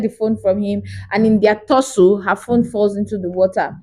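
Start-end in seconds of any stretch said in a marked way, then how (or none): none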